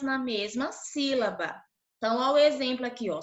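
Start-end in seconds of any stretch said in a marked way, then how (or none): none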